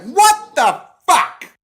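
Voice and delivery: slow, high, drawn-out shout; hammed-up outraged shout; shocked yell. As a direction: yell each word in comic disbelief. Acts Peter's shocked reaction.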